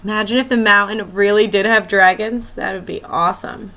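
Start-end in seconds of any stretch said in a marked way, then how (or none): none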